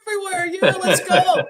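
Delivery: put-on giddy Boston drunk, laughing through it; rowdy Bostonian. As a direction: cheer about the flood like a delighted local. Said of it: "They would be like".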